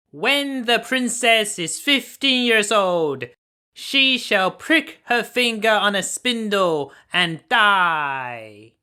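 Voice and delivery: high, loud, slow curse from the wicked fairy. A narrator's reading. The wicked fairy curses the royal couple, high, loud and slow. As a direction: pronounce the doom slowly and loudly. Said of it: "she said in a loud voice".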